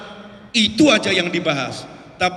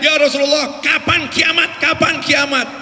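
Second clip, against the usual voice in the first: shouting question; 6 semitones higher than usual. Retold or imitated. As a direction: demand an answer at the top of the voice. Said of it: "Dia teriak-teriak". (teriakan mendesak bertanya)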